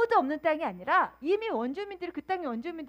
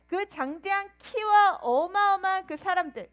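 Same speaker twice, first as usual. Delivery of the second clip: normal talking voice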